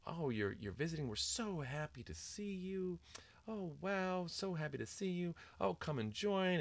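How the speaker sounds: high-pitched gushing school staff imitation; sing-songy phony voice. School staff greeting a visiting first grader, sing-songy and phony. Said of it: "That, that whole sing-songy, phony voice".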